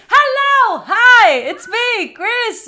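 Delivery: high, very loud drawn-out hello; over-loud, high-pitched acted greeting; screaming greeting. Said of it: "they're screaming".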